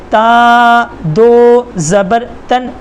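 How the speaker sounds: ता दो ज़बर तन, slow and drawn out, no अलिफ; correct spelling. Shown as a demonstration. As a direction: spell it slowly without अलिफ. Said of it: "बल्कि ... ऐसे पढ़ेंगे". (अलिफ के बिना धीमी हिज्जे)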